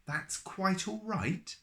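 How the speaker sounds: high, slow amazed question. A narrator's reading. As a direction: marvel at the words. Amazed repeat.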